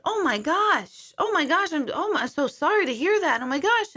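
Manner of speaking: high-pitched, stunned condolence; retold shocked sympathy. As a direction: re-enact pained surprise, pitch swooping up. Retells reacting to news of death.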